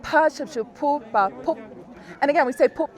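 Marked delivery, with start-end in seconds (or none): 2.7-2.9 "pup" cut off at the final p; stopped final consonant. Shown as a demonstration. Teacher shows learners where the word stops, stopped final consonant. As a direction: stop dead on the last p of "pup". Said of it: "It stops there".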